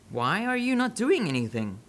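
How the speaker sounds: usual speaking voice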